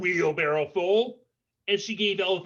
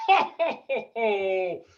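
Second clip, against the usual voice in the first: booming ho ho laugh, high then settling; elephant's big laugh; 4 semitones higher, slower than usual. Acted. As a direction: outlaugh the giraffe. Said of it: "trumpeted out an even bigger laugh".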